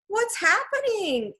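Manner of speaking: high, drawn-out cry of alarm; slow, high wail of confusion. A narrator's reading. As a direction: cry out in high-pitched panic. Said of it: "Annie cried".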